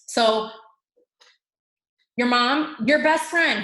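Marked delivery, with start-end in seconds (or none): none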